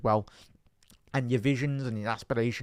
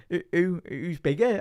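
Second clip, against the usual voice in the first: put-on Scouse accent; 6 semitones higher, 3 dB louder than usual. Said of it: "in the most Scouse accent".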